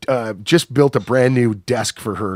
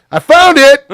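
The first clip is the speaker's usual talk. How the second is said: high, loud mock holler; retold shout; 13 semitones higher, 10 dB louder than usual. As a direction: shout it out like the guy in the car. Retold driver calling from car window.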